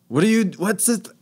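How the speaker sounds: high, excited French chef voice; French accent, shrill disbelief. Retold or imitated. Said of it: "in his French accent".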